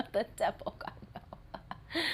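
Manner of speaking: soft, far-off scoff; a mocking breathy dismissal; hushed scoffing. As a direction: brush off the devil in a hush. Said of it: "They giggle".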